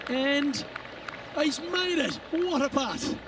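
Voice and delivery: rising thrill as the putt sinks; excited call. Real, not acted. Putt drops for the win.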